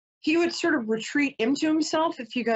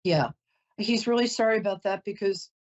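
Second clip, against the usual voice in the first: usual speaking voice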